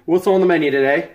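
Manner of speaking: put-on light British accent greeting. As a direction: ask it in a jolly British accent. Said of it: "Turned British".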